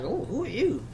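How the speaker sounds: ordinary delivery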